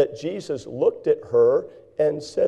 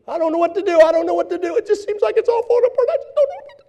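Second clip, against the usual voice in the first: very high, faster, climbing to a squeal; frantic panic; 7 dB louder, faster than usual. Acted. Preacher mocking a frantic churchgoer, squealing high panic. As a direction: panic piling up out of control. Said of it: "you're frantically panicking".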